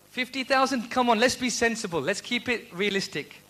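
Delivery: ordinary delivery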